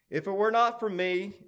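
high, loud little imp, boasting; cackling imp. A narrator's reading. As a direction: pipe up like a cocky imp. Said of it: "and he cackled".

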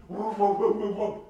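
loud, high rapid-fire woofs; imitated dog barking. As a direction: bark like a dog in someone's face. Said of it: "Just like that".

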